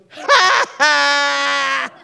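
high, loud gotcha "ha, ha"; mocking shout laugh. Sister finding her knocked-out brother, shouting a loud mocking "ha, ha". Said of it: "and she goes".

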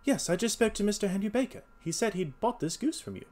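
high-pitched, polite detective; courteous detective. Acted. Voices Holmes in dialogue.

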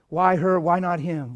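normal talking voice

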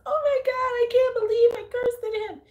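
very high, loud whiny panic; hammed-up squeal of horrified guilt; mock panicked teacher. Mimicking an overwhelmed colleague after she cursed at a student, mock panic. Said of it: "Her response is".

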